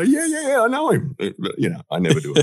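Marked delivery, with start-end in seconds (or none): 0.0-1.2 very high-pitched, put-on excited agreement; mock enthusiastic recognition. Guest playing along about a guy in Australia, high mock enthusiasm. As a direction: gush in falsetto as if you know him. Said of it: "And you go".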